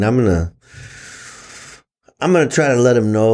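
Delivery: usual speaking voice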